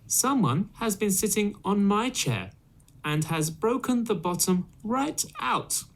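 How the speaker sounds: put-on high squeaky baby bear; little baby voice. Baby Bear finding his chair broken, high baby voice. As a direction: pitch way up like a small cub. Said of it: "in his little baby voice".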